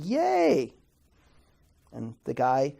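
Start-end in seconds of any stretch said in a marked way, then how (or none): 0.0-0.7 high, squeaky mock cheer; a goofy, very high yay for the class. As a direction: cheer it like a delighted student. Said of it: "you should be saying".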